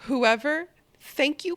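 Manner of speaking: ordinary talk, nothing marked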